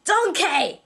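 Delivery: loud, high Scottish yell; Shrek's Scottish shout. Acted. Co-host mixing up characters, Scottish shout. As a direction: bellow it with a Scottish accent. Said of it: "I got him confused with Shrek".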